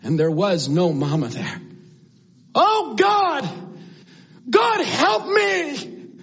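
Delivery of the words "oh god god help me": high, drawn-out plea; acted desperate holler, high-pitched; desperate cry to God. Acts sinking youth begging God.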